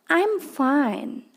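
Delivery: high cheerful I'm fine; bright English demo. Shown as a demonstration. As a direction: sound fine and happy. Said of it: "kamu bisa jawab".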